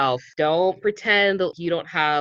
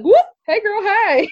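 put-on high, loud squealy hey girl; bubbly girlfriend greeting; 6 dB louder, slower than usual. Joking with her co-host, greeting like excited best friends. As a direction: squeal a greeting to your bestie. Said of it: "they're just like".

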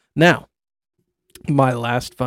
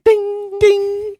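high sung "ding"; table ding. Retold or imitated. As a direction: chime it out high. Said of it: "it's like".

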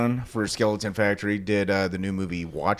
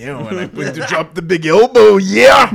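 Macho Man voice; 8 semitones higher, 13 dB louder than usual. Acted. Doing wrestler voice.

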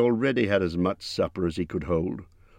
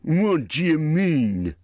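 troll voice; 10 semitones higher, 5 dB louder, much slower than usual. A narrator's reading. Bert demands.

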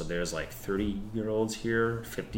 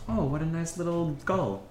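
higher sweet nun voice; Mother Superior cooing at a bird; stern nun; 9 semitones higher than usual. Acted. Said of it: "you hear Mother Superior's voice".

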